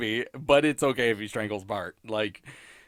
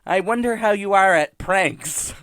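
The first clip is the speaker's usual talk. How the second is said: high, louder put-on kid voice; Bart; 7 semitones higher, 5 dB louder than usual. Bart wondering aloud to baby sister, imitated. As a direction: imitate a cartoon boy, pitched up. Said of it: "Bart says to Maggie".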